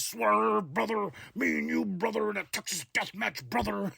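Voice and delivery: mock old-school promo growl, every brother punched; lightly voiced macho promo, brother on every line; hammed-up wrestling promo. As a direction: ham it up like a cartoon wrestler cutting a promo. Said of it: "He didn't say it like that".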